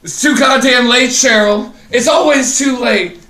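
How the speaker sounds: wild, high, shouted Cage voice; Nicolas Cage impression. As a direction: manic screaming brother impression. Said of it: "could you do an impression of, of your brother?".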